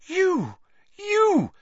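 very high, piercing cries; screamed accusation. A narrator's reading. Hermione pointing at Lupin, screaming. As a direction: fling each word out as an accusation.

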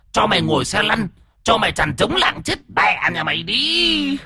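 high, hissed female-ghost curse; high strained ghost voice, venomous; screeching possessed woman. A narrator's reading. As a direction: spit curses as a vengeful woman spirit. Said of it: "thì lại rít lên".

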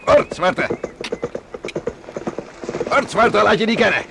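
loud, high hort to the horse; driving the horse. Acted. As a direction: drive the horse on with a shout. Racing horses on the beach. (luid hort roepen naar het paard)

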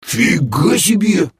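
high, slow stunned exclamation; amazed chorus. Acted. Bom and Krey gape at demoness, amazed drawl. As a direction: draw it out in amazement. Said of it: "хором изрекли Бом с Креем".

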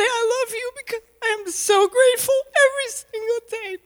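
up high, emotional and strained; high octave. Real, not acted. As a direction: lift it into a high register, moved. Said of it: "Wait, not this high octave".

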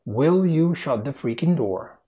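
slow, carefully separated words. Shown as a demonstration. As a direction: say it slowly so each word is clear. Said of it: "أبطأ سنة صغيرة".

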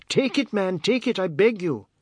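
high, urgent begging; beseeching wife, high and insistent; pleading. A narrator's reading. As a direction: plead loudly, voice pitched right up. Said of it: "gave a loud sob".

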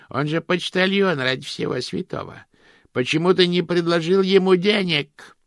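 very high, loud scolding crone; shrieking old woman. Acted. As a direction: screech at him like an old battleaxe. Josephine shrieking at Cosmo.

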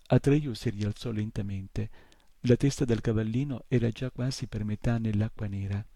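plain reading voice

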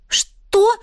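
high, startled interruption; frightened. A narrator's reading. Wife interrupting husband's plan, frightened. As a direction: cut in with a frightened jump in pitch. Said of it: "перепуганно перебила мужа Марьяна".

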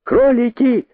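very high-pitched shouted call; crying out. A narrator's reading. Rabbit calling his fellows from the boa's belly, high shout. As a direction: cry out high to a crowd. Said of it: "кричал он".

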